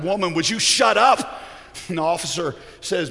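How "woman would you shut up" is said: mock outburst at a nagging wife; acted snap, raised in pitch; exasperated. Husband to wife who keeps contradicting him, exasperated. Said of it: "you know, exasperated".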